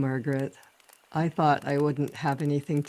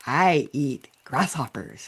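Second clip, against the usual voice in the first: lightly stretched, open-mouthed vowels; wide-mouthed frog. Acted. Frog telling the grasshopper his diet, mildly wide-mouthed. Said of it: "trying to open my mouth really wide".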